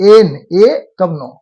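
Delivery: "एन, ए तब नो" slow, N split into an e vowel then no; vowel first. Shown as a demonstration. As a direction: spell out the sounds inside "एन", stretched. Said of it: "vowel से ही उच्चारण होता है".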